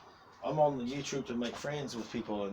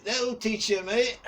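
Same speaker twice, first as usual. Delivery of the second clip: higher and louder, "That'll teach you" thrown out as a playful bark; mock telling-off; 10 semitones higher, 8 dB louder, slower than usual. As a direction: shout "That'll teach you, mate" like a big brother half joking. Mock telling off a younger mate.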